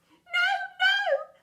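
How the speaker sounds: high, loud panicked wail; child's cry. A narrator's reading. Child begging the teacher, panicked high cry. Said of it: "the child would cry".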